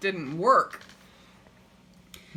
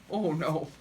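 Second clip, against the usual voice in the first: ordinary delivery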